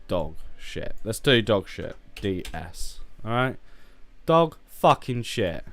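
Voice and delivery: ordinary talk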